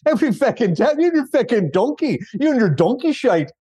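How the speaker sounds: high-pitched, hammed-up Irish lilt; bad Irish accent. Moviegoer mimicking the film's donkey lines, bad Irish accent. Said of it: "doing a bad Irish accent".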